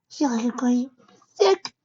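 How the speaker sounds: put-on high young filly, short of breath; filly voice, gasping. A narrator's reading. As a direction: gasp out the words as a sick young pony. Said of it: "she answered between gasps".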